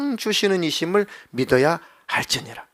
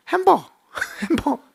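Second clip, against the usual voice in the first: 높고 어린 목소리로 단어를 조르는 아기; 처음 배운 단어로 조르는 아기처럼; 9 semitones higher than usual. Retold or imitated. Toddler first asking for hamburger. (아빠에게 햄버거를 조르는 아기, 높은 아기 목소리)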